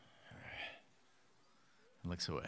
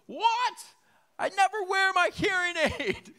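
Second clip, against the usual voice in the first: much higher, louder hammed-up old lady shout, laughing; deaf old woman; 10 dB louder than usual. Old neighbor explaining she can't hear, laughing old lady shout. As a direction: yell like a granny without her hearing aid.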